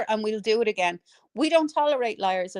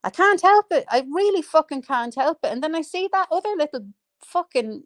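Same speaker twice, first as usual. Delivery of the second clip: annoyed; 5 semitones higher than usual. Real, not acted. Admitting they can't stop ranting, annoyed. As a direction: burst out in exasperation. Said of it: "I'm getting annoyed now".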